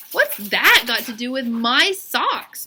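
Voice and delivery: put-on a kid who wants his question back on his own topic; impatient. A narrator's reading. Mac, cut off by Stephen's story, asks impatiently. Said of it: "Mac asked impatiently".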